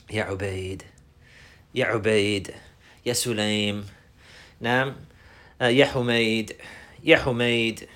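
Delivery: put-on low, soft, tender address to a child; nice and kind. Shown as a demonstration. Teacher showing how to address little children kindly, gentle and low. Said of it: "to be nice and kind to the little people like this".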